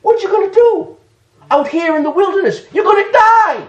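a hint of God challenging a runaway; mild mock-stern divine voice; the Lord pressing her. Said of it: "He's saying".

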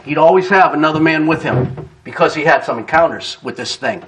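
ordinary delivery

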